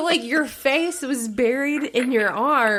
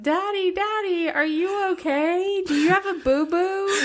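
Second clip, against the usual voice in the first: put-on higher, childlike voice, a touch tearful; worried little kids; 8 semitones higher than usual. Wife imitating kids calling their fallen dad, higher and tearful.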